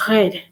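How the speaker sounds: "red" with a guttural French R. Shown as a demonstration. Teacher showing viewers how the French would say it, "red" with guttural R. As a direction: start "red" on a throaty French R. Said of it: "If the French are gonna pronounce red".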